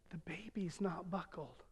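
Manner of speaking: soft, slow, hushed words; said quietly. Retold or imitated. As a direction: let it drop to a near hush. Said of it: "said quietly".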